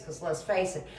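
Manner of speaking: ordinary talk, nothing marked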